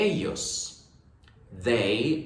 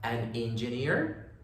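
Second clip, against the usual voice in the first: slow, careful English question with a rising end; slow model question; 6 dB quieter, much slower than usual. Shown as a demonstration. Teacher shows the inverted question to learners, slow with a rising end. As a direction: spell the question out slowly, lifting at the end. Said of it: "La cambiamos".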